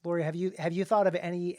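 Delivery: usual speaking voice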